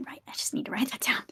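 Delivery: whispering. Acted. Character whispers in play.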